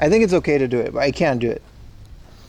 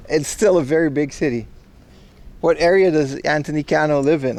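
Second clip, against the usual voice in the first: usual speaking voice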